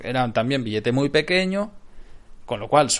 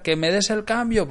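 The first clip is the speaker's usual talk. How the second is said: mock shout, pitched up high; retold angry yell; 8 semitones higher than usual. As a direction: imitate the clerk yelling for his change. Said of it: "le empezó a gritar". (imitando al cajero gritando que le den el cambio)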